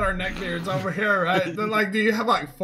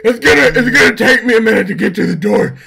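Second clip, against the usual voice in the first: mock wheezy nerd; 10 dB louder, faster than usual. Mock neckbeard voice, laughing.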